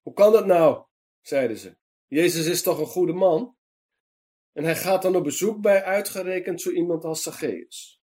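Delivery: Dutch: verontwaardigd en boos; angry crowd. A narrator's reading. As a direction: grumble in outrage at the guest list. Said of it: "kwade mensen".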